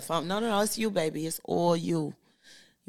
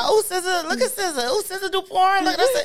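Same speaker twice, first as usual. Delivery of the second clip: mocking fans; 10 semitones higher than usual. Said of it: "everybody was saying".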